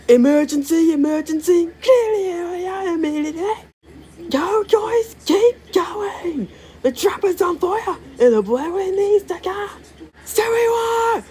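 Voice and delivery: very high urgent cartoon voice; alarmed engine. Engine raising alarm to the team, very high. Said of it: "test voicing characters".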